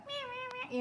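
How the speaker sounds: high mock cat meows. Imitating the hesitant cat Oliver, high mock meows. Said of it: "Oliver's like".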